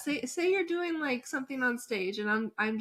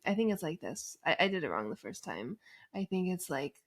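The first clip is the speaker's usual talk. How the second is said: low, soft creaky fry; 6 semitones lower, 5 dB quieter, faster than usual. Shown as a demonstration. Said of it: "a little creaky".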